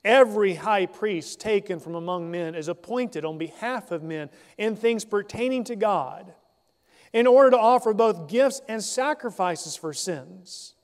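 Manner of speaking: formal scripture read. Acted. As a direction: read the verse out to the congregation. Reads scripture passage aloud.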